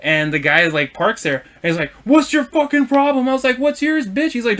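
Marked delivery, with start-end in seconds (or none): 2.1-3.3 high-pitched imitation of an irate driver; mock-angry challenge; retold angry confrontation. Truck driver confronting another driver, retold angrily. 3.6-4.3 high, combative comeback; retold angry retort. Driver answering the truck driver, retold angry retort.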